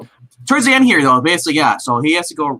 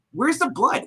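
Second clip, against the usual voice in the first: amused question; 11 semitones higher, 3 dB quieter, slower than usual. Retold or imitated. Quoting own thought, amused.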